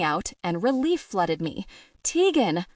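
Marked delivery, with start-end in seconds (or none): 2.0-2.7 loud searching call, pitched up. Acted. Noah calls out for Tegan in the bar, loud. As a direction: call her name across the room.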